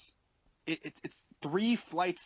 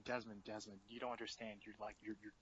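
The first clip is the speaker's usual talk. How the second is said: quiet; 7 semitones lower, 14 dB quieter than usual. Retold or imitated. Pleading with drunk friend.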